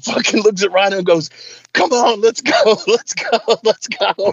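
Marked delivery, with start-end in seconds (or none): 1.7-4.3 pitched-up retold hurry-along; imitated urging. James urges Rhino back to the car, mock urgent. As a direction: hustle him along, half laughing.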